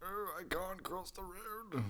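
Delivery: high, soft old-man impression. Shown as a demonstration. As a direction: put on a feeble old man. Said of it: "an old man voice, you go".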